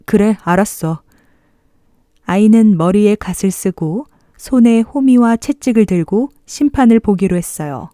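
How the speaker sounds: plain reading voice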